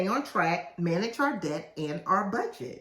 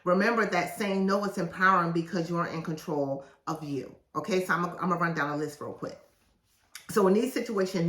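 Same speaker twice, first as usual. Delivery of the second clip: ordinary talk, nothing marked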